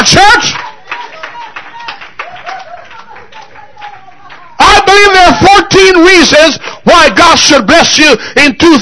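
fiery revival preaching; preaching at full force. Acted. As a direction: preach it out to the whole church. Pastor preaching to congregation.

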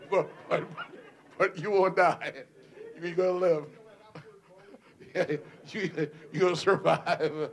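chuckling through the words; laughing reassurance. Real, not acted. Joking to the mayor.

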